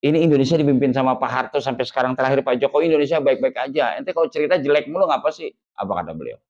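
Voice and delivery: usual speaking voice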